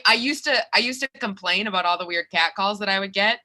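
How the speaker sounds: normal talking voice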